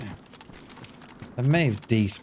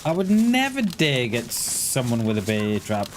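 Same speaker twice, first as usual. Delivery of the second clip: usual speaking voice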